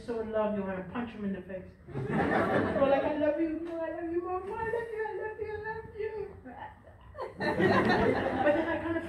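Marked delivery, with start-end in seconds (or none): 3.0-6.1 lightly voiced gushing lovers, rising higher; mock lovey couple. Mocking young lovers to each other, gushing. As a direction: gush it, sweeter and sillier.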